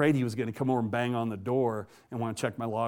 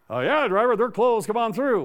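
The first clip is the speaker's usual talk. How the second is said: higher, louder mimicked call over the radio; breezy come-on-in radio voice, pitched up; CB radio reply; 8 semitones higher, 5 dB louder than usual. Officer luring a trucker over the CB, higher and louder mock call.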